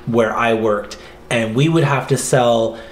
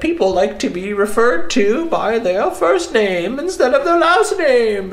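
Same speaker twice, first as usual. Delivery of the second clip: much higher, slowed, put-on voice; mock smug manager; 10 semitones higher than usual. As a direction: ham up a pompous boss announcing findings. Mocking the call centre manager.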